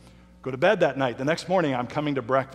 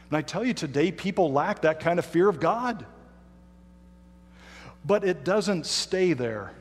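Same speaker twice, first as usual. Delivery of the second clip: earnest sermon preaching; 3 semitones higher than usual. Acted. Preacher urging the congregation toward loving fear of God, earnest and raised.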